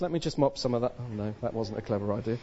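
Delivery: ordinary talk, nothing marked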